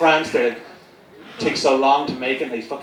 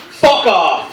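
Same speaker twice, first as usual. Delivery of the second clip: loud, higher-pitched shout; mock outburst; 6 semitones higher, 7 dB louder than usual. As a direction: blurt it out at the priest as a punchline. Baby's first words to priest.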